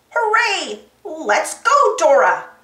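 loud, excited cheer; eager agreement. A narrator's reading. Monkey cheers Dora on to the adventure, excited. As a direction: cheer your friend on. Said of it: "Boots agreed".

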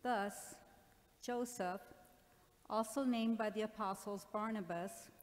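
ordinary talk

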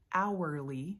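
HOURLY said slowly as "OW-er-lee"; slow, fully enunciated HOURLY with a clear "ow"; careful enunciation. Shown as a demonstration. Trader explaining why she says it so slow, careful enunciation. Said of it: "say hourly and I slow myself down".

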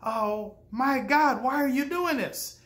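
high-pitched, alarmed outburst; upset psychologist. Retold or imitated. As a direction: cry out in shocked dismay at the boy. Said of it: "the psychologist became quite upset".

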